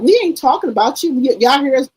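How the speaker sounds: mocking the relatives. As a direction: imitate the aunties brushing it off. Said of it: "they said".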